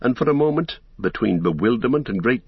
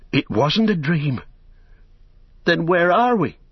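high-pitched, startled cry; crying aloud; 8 semitones higher than usual. A narrator's reading. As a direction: cry out in bewildered alarm. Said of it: "Sam cried aloud".

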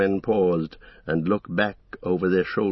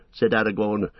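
usual speaking voice

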